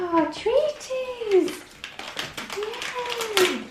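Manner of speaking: high, slow delighted squeal; excited. Real, not acted. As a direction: gush over the treats. Opening treats present for dog.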